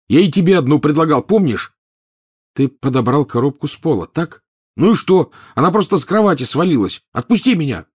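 high, loud hanging protest; shouting protest. Acted. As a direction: yell while dangling. Ron upside down protesting.

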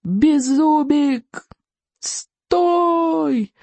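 high, long-drawn strangled call; hushed shouting. A narrator's reading. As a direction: shout without making noise, stretching each word. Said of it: "пытаясь кричать и не шуметь одновременно".

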